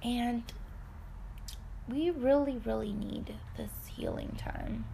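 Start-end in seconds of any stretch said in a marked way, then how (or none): 2.6-4.8 sudden whisper on the key phrase. Real, not acted. To viewers about healing time, whispered. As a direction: hush it as if confiding.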